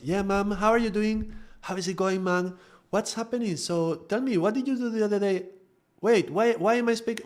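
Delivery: put-on very high child-to-parent voice; high-pitched, submissive. Grown child chatting with mother, high submissive voice. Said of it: "why am I speaking up there?".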